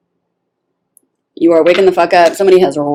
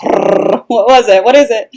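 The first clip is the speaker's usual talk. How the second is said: raised, lightly hammed-up eagerness; eager anticipation; 5 semitones higher, 4 dB louder than usual. Reader voicing her spirit after a drum roll, mock eager anticipation. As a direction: ask it higher, as if awaiting a reveal.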